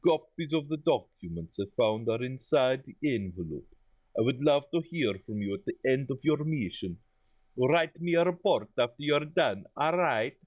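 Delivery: put-on thick comic foreign accent, sliding into parody; hammed-up Eastern European accent; Borat accent. Boss asking Laura for a report, accidental Borat accent. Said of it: "Fuck, we went Borat".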